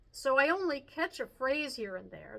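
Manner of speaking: usual speaking voice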